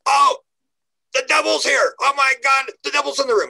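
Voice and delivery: high-pitched mock panic; freaking out. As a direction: cry out as if terrified, playing it up. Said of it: "he's getting all freaking out".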